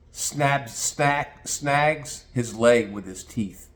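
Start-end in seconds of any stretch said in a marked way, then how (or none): none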